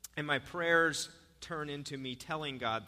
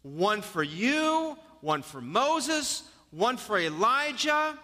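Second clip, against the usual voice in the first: slow, drawn-out eager proposal; eager slow Peter; 6 semitones higher, much slower than usual. Acted. Peter eagerly proposes tents to Jesus, slow and drawn out. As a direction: spell out each tent slowly like an excited disciple.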